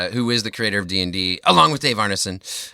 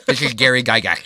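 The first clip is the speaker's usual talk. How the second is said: lisp. Retold or imitated. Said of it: "he talked like this".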